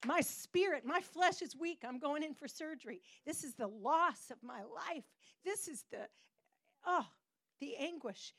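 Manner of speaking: high, loud preaching, rising to anguish; preaching Mary's song. Acted. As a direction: proclaim it like a psalm, voice lifted.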